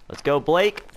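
loud hype before the jump; excited. Real, not acted. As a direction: pump yourself up. Cheering himself into a jump.

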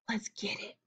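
urgent whisper to the gang. A narrator's reading. Hollis urging the gang to grab the money, whispering. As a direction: breathe it so nobody else hears. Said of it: "whispered Hollis".

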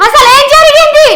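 loud, insistent questioning; angry demand. Acted. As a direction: demand the truth, shouting. Demanding to know what happened.

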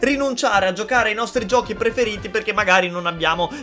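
soft, steady sponsor read. Acted. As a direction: read it like a quick sponsored spot.

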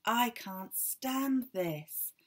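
exasperated. A narrator's reading. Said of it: "said Mister Bear".

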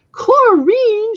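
put-on old witch shouting at her blabbing friend; shouting a name. A narrator's reading. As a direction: yell her name to stop her. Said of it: "shouted Ratinki".

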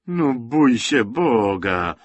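slow, shocked "bój się Boga"; drawn-out horrified exclamation; exclaiming. A narrator's reading. Galicowa to Zosia, slow shocked exclamation. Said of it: "wykrzyknęła Galicowa".

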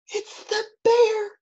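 put-on high-pitched cry of a scared child; high little-boy alarm; frightened cry. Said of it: "cried Eddie".

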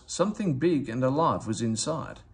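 plain reading voice

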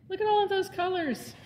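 bright excited pointing-out; marveling. Acted. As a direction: show the kids something wonderful. Reader marvels at dream colors.

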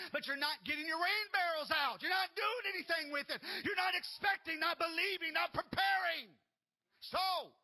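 loud, high fiery preaching; preaching at full force. Acted. Pastor urging the congregation, fiery loud preaching. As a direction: rouse the church to prepare for rain.

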